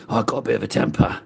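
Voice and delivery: a hint of a mock-menacing friend; imitating Fundy. Streamer mimics chat friend, mild menace. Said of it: "that's how Fundy sounds".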